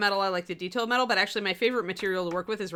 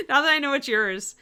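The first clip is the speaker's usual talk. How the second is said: laughing; 6 semitones higher, 6 dB louder than usual. Real, not acted. Laughing at her joke.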